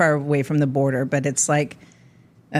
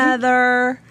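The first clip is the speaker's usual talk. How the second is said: put-on Valley Girl-Southern accent; 7 semitones higher than usual. Woman mimics relatives greeting her, Ohio River Valley accent. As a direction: imitate Valley Girl crossed with Southern, pitched up. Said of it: "L A Valley Girl and Southern".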